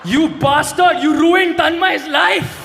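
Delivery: mock outrage. Mock outrage at roast target.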